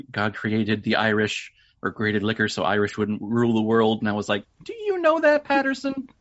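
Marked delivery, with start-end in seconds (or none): 4.6-6.1 high, squeaky mock question; mock incredulous voice. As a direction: needle him in a high cartoonish voice. Mock voicing Patterson about Irish line.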